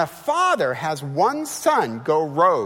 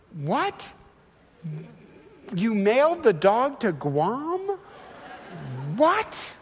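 1 incredulous disbelief; 5 semitones higher, slower than usual. Acted. Exasperated parent reacting to kids.

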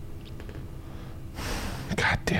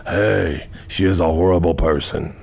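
usual speaking voice

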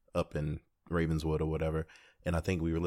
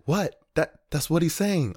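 confused friend; 9 semitones higher, 5 dB louder than usual. Retold or imitated. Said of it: "He's like".

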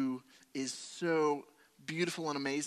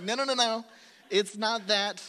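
louder, higher rapid no's, brushing the idea off; emphatic denial; 8 semitones higher, 9 dB louder than usual. Retold or imitated. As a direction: wave it away firmly. Protesting to rabbi friend.

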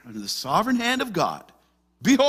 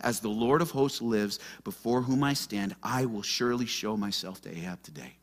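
soft, low and gentle; tender; 8 semitones lower, 9 dB quieter than usual. Retold or imitated. Elijah gently reassuring frightened Obadiah he will appear. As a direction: reassure him quietly. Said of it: "just so tender in his response".

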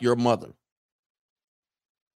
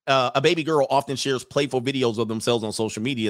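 ordinary talk, nothing marked

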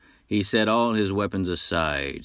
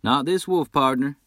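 a higher, louder cocky wolf hunter's voice; boastful hunter; 9 semitones higher, 5 dB louder than usual. Acted. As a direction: brag like a swaggering frontier hunter. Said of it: "said Nash".

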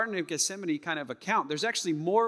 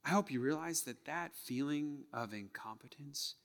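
whisper; 3 semitones lower, 9 dB quieter than usual. Real, not acted. Intimate reflective sermon moment.

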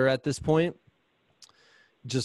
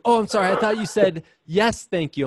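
higher, louder flustered apology from mom; imitating mom; 7 semitones higher, 4 dB louder than usual. Mom caught offering meat sticks, flustered apology. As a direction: play the startled, sorry mother.

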